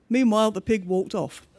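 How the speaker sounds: ordinary delivery, nothing marked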